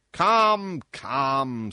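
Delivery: loud, high, impatient urging. Acted. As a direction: hurry him along, annoyed. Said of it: "said the king impatiently".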